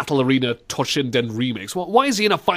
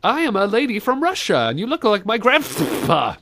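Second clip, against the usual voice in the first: higher-pitched hammed-up Russian accent; mock Russian lady; 8 semitones higher than usual. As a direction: play a Russian cabaret lady. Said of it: "not a Russian cabaret lady".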